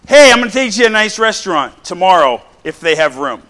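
mock eager promise, loud and high; last-minute offer. Preacher acting a husband springing a dinner on his wife, mock eager.

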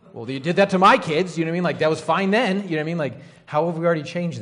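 loud, high, indignant protest; indignant outburst. Real, not acted. Mother objecting to nurses' new rules, loud indignant protest. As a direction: let the outrage spill over, loud and high. Indignant retort about her own kids.

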